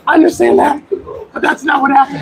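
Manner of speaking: excited protest. Real, not acted. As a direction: push back, pitch shooting up.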